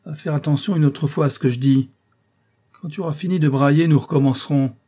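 exasperated teacher lecturing loudly; stern scolding. A narrator's reading. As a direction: harangue the pupil with threats and orders. Teacher scolding beaten pupil.

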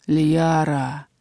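low, drawn-out call from the dark; ominous low man's voice; low male voice. A narrator's reading. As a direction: call the name slowly, deep in the voice. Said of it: "прозвучал низкий мужской голос".

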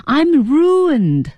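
acted lament. Teacher voicing the character imagining his future, drawn-out lament. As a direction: stretch out the ruin, imitating the character. Quotes the character's despairing line.

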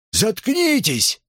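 high, slow jeering cry; heckler's yell. A narrator's reading. Student shouting down the speaker, high yell. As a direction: stretch it high over the crowd. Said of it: "Эти вопли неслись со всех сторон".

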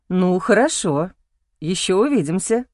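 a hint of a kind older man; smiling man's voice. A narrator's reading. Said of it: "улыбнулся мне".

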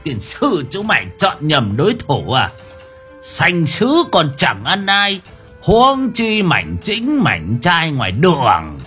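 high, drawn-out singsong taunt; gloating singsong. A narrator's reading. Exorcist taunts his beaten foes, singsong and high. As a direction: crow it in a lazy, lilting singsong. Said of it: "Ông nghêu ngao".

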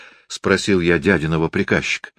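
usual speaking voice

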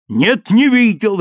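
loud, high, slow angry bark. Acted. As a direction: snap it back angrily. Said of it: "рявкнул я".

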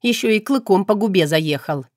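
ordinary delivery

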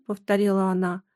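plain reading voice

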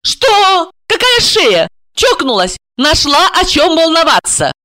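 high, indignant outburst; irritated, high-pitched telling-off; angry. A narrator's reading. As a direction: scold with rapid-fire incredulous questions. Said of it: "Та рассердилась".

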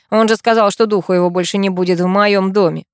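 plain reading voice

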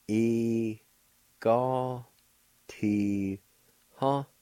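E-ga- ti ha spoken one syllable at a time. Shown as a demonstration. Teacher showing learners the first part, syllable by syllable. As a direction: break E-ga- ti ha into separate syllables. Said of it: "First part is pronounced like this".

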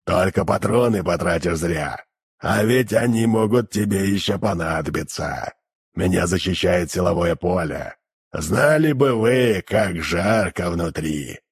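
low, slow muffled demon. Acted. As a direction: drone it like a hellish creature. Demon keeps his voice.

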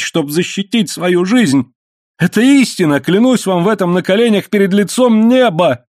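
halting high-pitched explanation, building to an oath; incoherent outburst. A narrator's reading. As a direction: plead desperately, stumbling over words. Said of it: "Слова вырывались у него несвязно".

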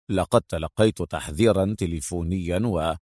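low, sober explanation; serious. A narrator's reading. Adham explaining the warning to the inspector, serious. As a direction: explain it gravely, then get cut off. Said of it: "قال أدهم بجدية".

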